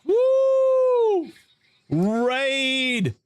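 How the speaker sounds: high, drawn-out whooping announcement; excited hype. Real, not acted. Streamer greeting an incoming raid, excited hype. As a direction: stretch each word out high, hyped up.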